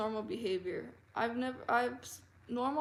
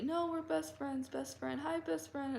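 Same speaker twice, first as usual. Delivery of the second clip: mock gushing friend; 6 semitones higher than usual. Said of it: "continued to say".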